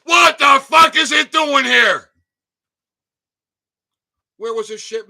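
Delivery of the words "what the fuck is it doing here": loud, higher demand; outraged shout. Real, not acted. Asking viewers about the label: an angry yell. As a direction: fling the question at the room.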